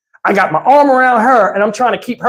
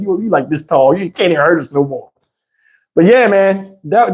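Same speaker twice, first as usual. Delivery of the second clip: usual speaking voice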